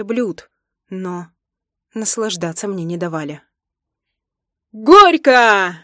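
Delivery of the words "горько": loud wedding toast shout. Acted. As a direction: yell it across the hall. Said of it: "кричал Тамада".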